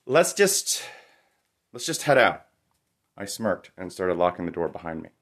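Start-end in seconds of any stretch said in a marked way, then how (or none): 0.0-2.5 higher, impatient and frustrated. Acted. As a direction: cut him off, wanting to leave now. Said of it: "Her face was coiled in frustration".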